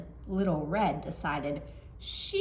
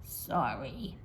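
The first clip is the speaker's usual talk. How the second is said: softer, lower, meek apology; politely; 7 semitones lower, 7 dB quieter than usual. Acted. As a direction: say it small and well-mannered. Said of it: "ever so politely".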